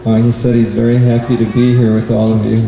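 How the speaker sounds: plain reading voice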